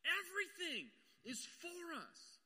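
high, slow, ringing climax; a pastor crying out the promise; soaring exclamation. Acted. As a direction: lift it up high and slow like a revelation. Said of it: "He says".

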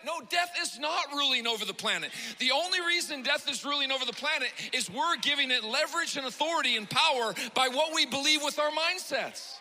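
loud, high preaching that builds to a shout; preaching at full force. Acted. Pastor rebuking a fearful mindset, preaching loud and high. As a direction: drive it home to the congregation.

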